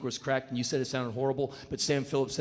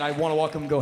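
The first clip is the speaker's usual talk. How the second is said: usual speaking voice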